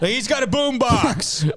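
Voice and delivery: high, drawn-out alarm cry; hammed-up panic over a harmless threat; mock panicked cop. Cop about to shoot over music, mock panic.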